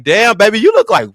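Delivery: high, loud, eager compliment; excited flirting. Retold or imitated. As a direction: gush at him like he's gorgeous. Flirting with him at work.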